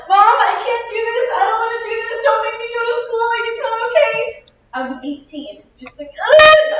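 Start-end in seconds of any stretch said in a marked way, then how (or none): 0.1-4.4 high, loud, tearful pleading to mom; reenacted sobbing plea, much higher and louder; mock bawling. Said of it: "just straight up bawling". 6.1-6.7 loud, high crying groan; a mock ugly-cry wail. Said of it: "ugly crying".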